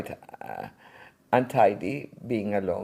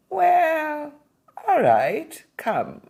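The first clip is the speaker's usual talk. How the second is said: put-on much higher, louder old-man voice giving in; reluctant old gentleman saying yes; hesitant old man; 6 dB louder, much slower than usual. Voicing the elderly man.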